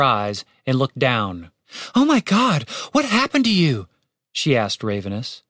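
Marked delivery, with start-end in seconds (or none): 1.9-3.9 higher, startled voice; shocked concern. A narrator's reading. Catherine notices the shot raven, startled. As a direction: exclaim on seeing an injury.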